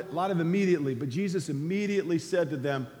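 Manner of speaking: normal talking voice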